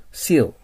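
seal with a clean initial s, falling firmly; S pronunciation model. Shown as a demonstration. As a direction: model seal as "see-l", never "shee-l". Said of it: "発音はSの".

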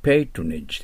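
patronage said as PAY-tro-nij, not pa-to-ro-na-ge; correct pronunciation. Shown as a demonstration. Teacher correcting the katakana reading of patronage. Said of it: "パトロナゲと書かれてますけど".

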